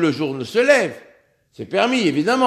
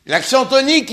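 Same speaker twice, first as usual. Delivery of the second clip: ordinary talk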